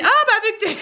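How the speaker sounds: high, hammed-up mock quote of husband; caricatured partner's voice; exaggerated husband. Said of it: "Il me dit pas comme ça".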